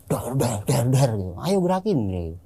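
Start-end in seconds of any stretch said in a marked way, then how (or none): 0.1-1.1 rapid raised banging sounds; higher-pitched mimicked thuds; imitated banging noise. 1.4-2.0 high-pitched goading shout, retold; retold scolding, pitched way up; grumbling scolding. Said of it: "ngomel di dalam mobil".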